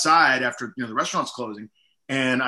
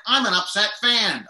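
lightly hammed-up upset fan, pitched higher; mock upset fan; 7 semitones higher than usual. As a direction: pitch it up as a put-on disgruntled fan. Voicing his weekly upset-fan video character.

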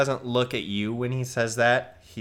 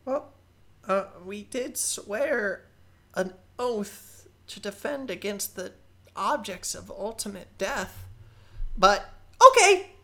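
higher, eager nervous goblin rambling; young goblin sorcerer voice; 12 semitones higher, 5 dB louder than usual. Acted. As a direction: play a keen but unsure goblin kid. Balthazar swears loyalty.

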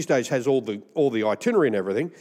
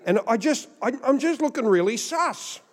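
very high, squeaky mock panic; comic self-awareness; 10 semitones higher than usual. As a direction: let the voice climb in comic dismay. Realises he looks suspicious at customs.

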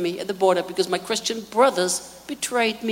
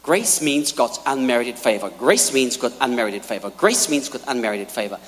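mimicking preachers; 11 semitones lower than usual. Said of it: "When preachers preach".

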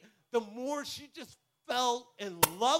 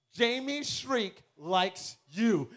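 dramatic slow reveal; much slower than usual. Retold or imitated. Friend breaks the big news.

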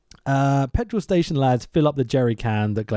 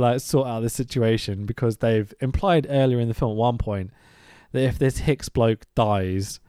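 ordinary delivery

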